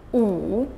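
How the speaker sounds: "wu" dipping low, then rising; third tone. Shown as a demonstration. Teacher practising syllables in four tones, "wu" falling then rising. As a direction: sink "wu" down, then scoop it up. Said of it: "in each of the four".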